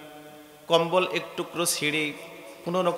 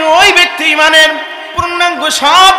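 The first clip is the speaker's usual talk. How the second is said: high, shouted sermon proclamation; full-force preaching; 12 semitones higher, 13 dB louder than usual. A narrator's reading. Preacher proclaims the Prophet's saying about faith, high full-force shout. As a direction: shout it to the congregation, high and emphatic.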